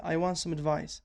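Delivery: "some" reduced to a weak "səm", run into "advice"; unstressed some. Shown as a demonstration. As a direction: swallow "some" so it sounds like "səm". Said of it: "الصحيح بشوات اللفظ تصير".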